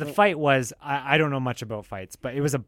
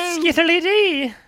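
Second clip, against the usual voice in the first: mock Irish; 7 dB louder, much slower than usual.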